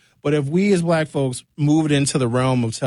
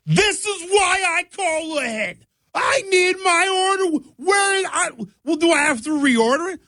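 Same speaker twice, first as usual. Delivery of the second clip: angry demanding yell. Retold or imitated. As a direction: play an entitled anchor yelling at the barista for her order. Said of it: "She yelled".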